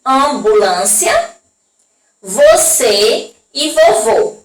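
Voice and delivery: slow, careful reading of each example; slow demo words. Shown as a demonstration. Teacher slowly reading circumflex words to the class. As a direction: sound out each word slowly. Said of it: "colocou três palavras aí que utilizamos o acento circunflexo".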